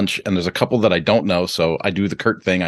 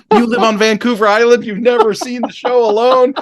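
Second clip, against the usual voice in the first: pitched up high, incredulous questions; can't believe they never saw it; mock disbelief; 13 semitones higher, 4 dB louder than usual. To the Vancouver Island couple, high mock disbelief.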